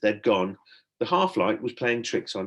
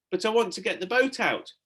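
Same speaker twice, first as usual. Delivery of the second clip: protesting; 10 semitones higher than usual. A narrator's reading. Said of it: "he protested".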